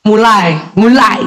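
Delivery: slow mock grumbling hums, then mulai mulai; impatient mother grumbling. Mother grumbling at her son who won't answer, slow and impatient. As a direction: hum your annoyance at the child. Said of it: "Jangan kita bilang".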